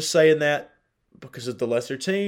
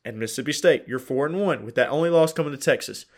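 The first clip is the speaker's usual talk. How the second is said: ordinary delivery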